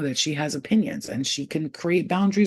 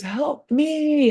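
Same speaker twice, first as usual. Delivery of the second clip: narrated cry for help; 9 semitones higher, 4 dB louder than usual. A narrator's reading. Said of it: "he screamed out to the universe".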